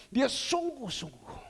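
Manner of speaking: ordinary talk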